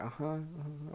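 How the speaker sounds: soft, high hummed uh-huhs; softly faked listening noises; retold muffled acknowledgement. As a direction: hum along quietly, pretending to listen. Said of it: "'cause I was like".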